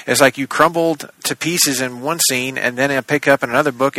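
normal talking voice

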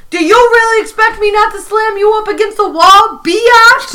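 high, loud bad New York accent; Prison Mike. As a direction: threaten like a hammed-up New York convict. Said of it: "really bad New York accent".

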